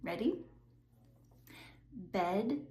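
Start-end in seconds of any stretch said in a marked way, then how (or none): 2.1-2.6 Bed with a buzzing voiced D at the end; voiced final D. Shown as a demonstration. Teacher modelling the voiced ending of Bed. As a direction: let the D in Bed ring with voice. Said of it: "I will say the voiced word first".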